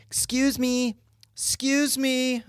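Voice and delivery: high, drawn-out mock call; calling lady. Imitating the lady calling across the dog park, high.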